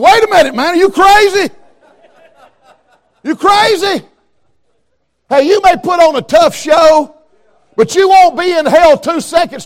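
a scared tough biker crying out; alarmed biker. Retold or imitated. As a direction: play the biker suddenly spooked. Preacher voices scared biker.

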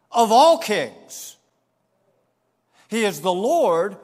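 slow, ringing proclamation; emphatic preaching. Real, not acted. Preacher declaring God King of all kings, emphatic. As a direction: proclaim it in measured phrases, punching the key words.

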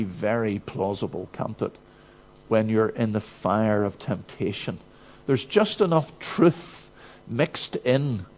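usual speaking voice

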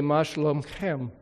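"Ma shalomchem" in Hebrew, a throaty kh before the final "em"; a pastor greets the whole congregation in Hebrew, plainly; Hebrew to a group. Shown as a demonstration. מה שלומכם. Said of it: "If I was talking to all of you, I'd say".

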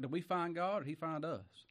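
ordinary talk

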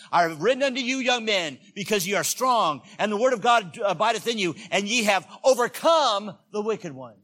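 loud, high preacher's proclamation; forceful scripture reading. Acted. Preacher reading John's verse to the congregation, loud proclamation. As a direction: declaim the verse at full force.

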